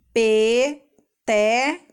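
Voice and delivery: "Peteca" very slowly as pe... te... ca; three drawn-out beats in "Peteca"; syllable by syllable. Shown as a demonstration. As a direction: piece "Peteca" together syllable by syllable. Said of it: "vamos juntar e formar a palavra".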